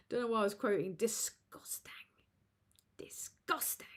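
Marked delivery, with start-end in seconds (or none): 0.9-1.9 disgusted whisper. Acted. Whispered reaction to a super chat. 3.0-3.9 disgusted whisper. Acted. Whispered again, pitched higher.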